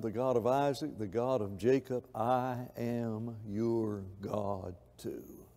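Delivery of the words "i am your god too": very slow, soft and low; God speaking quietly to one person; tender voice of God. Acted. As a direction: gently promise it to the listener. God's voice, tender close.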